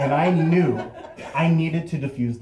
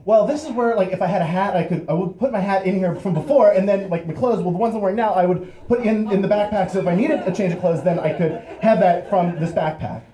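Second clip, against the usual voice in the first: high, loud, rushed false starts; panicked stammering; 7 semitones higher, 6 dB louder, faster than usual. Acted. Friend explaining an empty backpack to cop, stammering. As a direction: trip over the excuse, restarting and speeding on. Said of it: "Jason just starts stammering".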